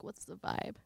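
ordinary talk, nothing marked

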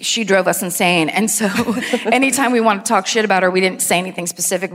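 ordinary delivery

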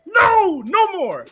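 high-pitched, fed-up outburst climbing to a squeal; exasperated rant. Real, not acted. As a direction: lose it completely, voice shooting way up. Worked-up rant about the app.

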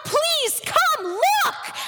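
high, drawn-out shout of warning; father's pleading cry, each word stretched; desperate yell. Acted. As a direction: yell out slowly to a child in danger. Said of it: "yelled out".

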